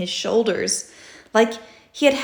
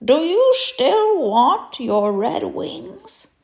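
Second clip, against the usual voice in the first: slow, higher, knowing question; wise old groundhog; 7 semitones higher, 5 dB louder, slower than usual. Acted. Mister Groundhog asking the stuck rabbit, slow knowing voice. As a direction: gently tease the little friend. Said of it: "He said to his little friend".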